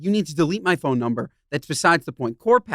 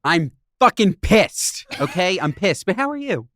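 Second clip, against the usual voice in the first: angry. Real, not acted. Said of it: "I love an angry [name]".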